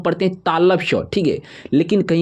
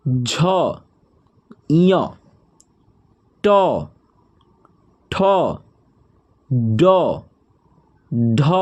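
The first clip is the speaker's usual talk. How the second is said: one letter at a time with long pauses; alphabet recitation; 2 semitones lower, much slower than usual. Shown as a demonstration. Teacher reciting the Hindi alphabet to students, slow and deliberate. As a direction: call out each letter like a classroom drill.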